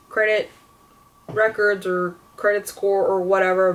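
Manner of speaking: ordinary talk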